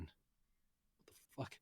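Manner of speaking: breathless, slow disbelieving whisper; whispered aside. Real, not acted. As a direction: mutter it under your breath. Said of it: "reader's aside at the text".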